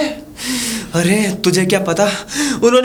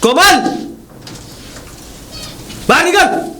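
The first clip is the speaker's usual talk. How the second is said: bellows her name and orders her out; angry call; 7 semitones higher than usual. Acted. Husband calls wife out angrily.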